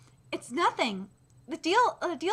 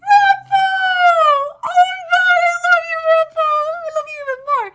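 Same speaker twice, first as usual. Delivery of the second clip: high loud ecstatic screaming; ecstatic fan scream; 15 dB louder than usual. Real, not acted. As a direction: shriek his name in delight. Screaming at a manga panel.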